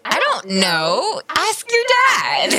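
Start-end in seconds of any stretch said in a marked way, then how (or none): 1.3-2.5 playful shout. Real, not acted.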